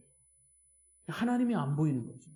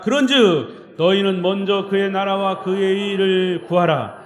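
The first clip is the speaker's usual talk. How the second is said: slow, loud chanted verse; unison scripture recitation; 8 dB louder than usual. Shown as a demonstration. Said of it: "시작".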